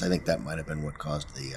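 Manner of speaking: normal talking voice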